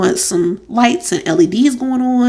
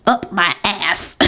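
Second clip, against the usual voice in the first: comic drawn-out delivery; 4 dB quieter, much slower than usual. Real, not acted. Speaker joking about everyone during her pregnancy, comically drawn-out. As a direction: drag it out quietly, about to crack up. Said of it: "why I said it like that".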